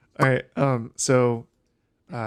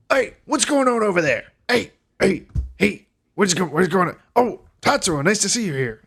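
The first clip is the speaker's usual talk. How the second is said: higher, louder innkeeper calling out, then cheerful greeting; a loud, startled barkeep breaking up trouble; Dirk, bright and surprised; 9 semitones higher, 4 dB louder than usual. Acted. Innkeeper shouting across the bar to the orc, higher and louder.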